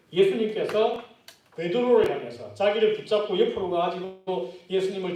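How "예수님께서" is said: ordinary talk, nothing marked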